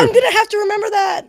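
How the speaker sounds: loud, high, gleeful exclamation; real amused delight at full voice. Real, not acted. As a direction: blurt it out, thrilled by the rude word. Delighted by rude new slang.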